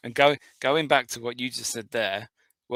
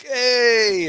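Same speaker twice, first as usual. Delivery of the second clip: high, loud, drawn-out hey; mock party greeting; 12 semitones higher, 8 dB louder than usual. Random people jumping into a photo, loud imitated cheer. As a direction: whoop it like greeting a whole crowd. Acting out strangers posing for photos.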